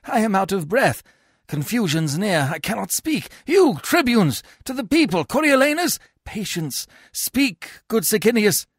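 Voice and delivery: high, urgent appeal; flustered pleading. A narrator's reading. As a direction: plead for calm amid confusion. Menenius pleads amid the riot.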